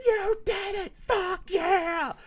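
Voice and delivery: high squealing cheer of joy; mock fan cheer. Mocking fans celebrating the title win, squealing cheer. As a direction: shriek it like an overjoyed fanboy.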